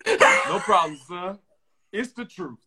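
a hint of a mother's reply; mom voice. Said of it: "My mom proceeds to say".

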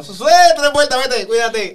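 loud, high choreographer calls; dance instructor calling out. Retold or imitated. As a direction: shout the dance cues. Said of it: "que era como". (coreógrafo gritando indicaciones)

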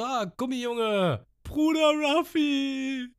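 very high, slow, drawn-out cry; overjoyed cheer. Retold or imitated. The crew greeting Ruffy, very high slow cheer. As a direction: sing out his name in joy.

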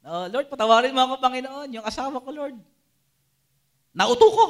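mock loud confession, peaking at the end; loud pleading prayer. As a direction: ham up the guilty plea, building to a loud high finish. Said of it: "di ba?".

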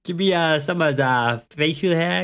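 a clumsy attempt at an Italian accent; bad Italian accent. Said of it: "the worst Italian accent I've ever heard".

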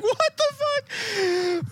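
slow, high stretched-out disbelief; stunned laughing. Retold or imitated. Brothers at the Hall of Presidents, high slow shock. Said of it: "We're just like".

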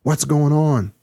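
high, frantic shout down the phone; panicked scream. A narrator's reading. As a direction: yell it in panic. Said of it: "Evan screamed into the phone".

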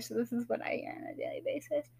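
hushed quick aside; whispering. Real, not acted. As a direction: whisper it fast under your breath. Aside about mom in healthcare.

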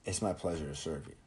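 soft, quiet scripted courtesy; polite staff line. Retold or imitated. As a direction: murmur it like a polite fast-food worker. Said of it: "they say".

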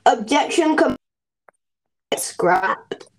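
flat robotic announcer. Pretend. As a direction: speak like a machine voice. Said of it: "It all sounded like a robotic voice".

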